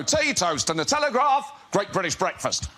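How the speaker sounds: politician impression, starting high and falling; Nigel Farage. Politician asked about his breakfast, answering in an imitated campaigning voice. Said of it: "And he'd go".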